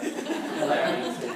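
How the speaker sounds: usual speaking voice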